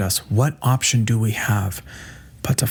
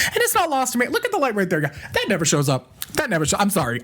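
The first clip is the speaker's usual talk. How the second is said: much higher, joking voice; playful high aside; 8 semitones higher, faster than usual. Acted. Speaker joking to viewers about the light, high and playful.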